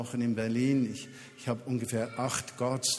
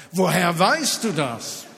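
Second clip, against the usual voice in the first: higher, louder and slowed, astonished; amazed question; 5 semitones higher, 8 dB louder than usual. Retold or imitated. Restaurant owner asks the pastor, astonished and loud. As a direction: let the disbelief ring out.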